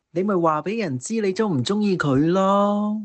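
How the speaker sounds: ordinary delivery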